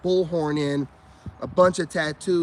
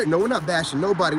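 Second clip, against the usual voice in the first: ordinary talk, nothing marked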